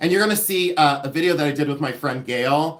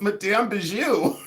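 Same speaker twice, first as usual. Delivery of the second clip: light mock French accent, slow and higher; put-on French accent; 5 semitones higher, slower than usual. Telling viewers the lady's YouTube ID, light put-on French accent. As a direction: stretch the name in a playful French accent. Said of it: "gonna be my best French accent".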